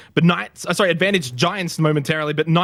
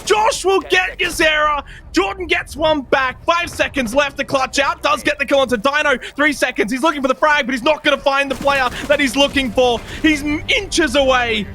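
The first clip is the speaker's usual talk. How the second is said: excited shouting; 8 semitones higher than usual. Real, not acted. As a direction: yell the final round live. Match-deciding clutch, live call.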